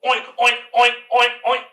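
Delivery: loud, high, punchy oinks; pig oinking. Pretend. Teacher teasing children about what the cow goes, loud pig oinks. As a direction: oink out a string of pig noises. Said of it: "the cow goes".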